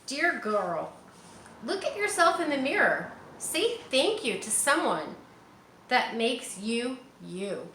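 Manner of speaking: put-on reading to children. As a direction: read it like a picture book to little ones. Reading picture book aloud.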